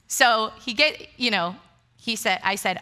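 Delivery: ordinary talk, nothing marked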